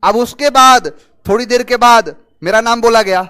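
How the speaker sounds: ordinary talk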